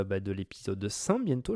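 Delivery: usual speaking voice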